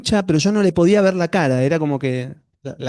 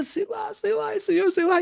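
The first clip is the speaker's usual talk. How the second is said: imitating a high, choked wail; retold choked anguish; 4 dB quieter than usual. Man trying to tell those around him the Lord is leaving, mock choked anguish. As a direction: act out distress through a knotted throat, pitched very high. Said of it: "tan angustiado, con un nudo en la garganta".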